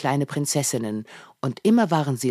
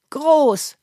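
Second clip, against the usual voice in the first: Tim; 11 semitones higher, 6 dB louder than usual. A narrator's reading. Tim answers father eagerly.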